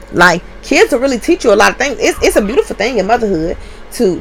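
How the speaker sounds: ordinary talk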